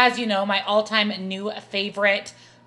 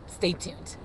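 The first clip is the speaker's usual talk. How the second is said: whispered; 4 semitones lower, 12 dB quieter than usual. Real, not acted. Vlog sign-off.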